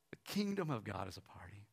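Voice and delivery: low, whispery reverence; hushed repeat. Real, not acted. Preacher repeats the book title to congregation, hushed. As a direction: let it sink in, almost whispered.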